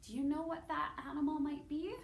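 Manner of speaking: normal talking voice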